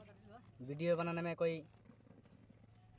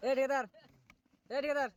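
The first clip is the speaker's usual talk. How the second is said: raised, louder hail; calling out; 5 semitones higher, 6 dB louder than usual. Real, not acted. Worker calling to the contractor, loud and high. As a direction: hail the contractor across the site.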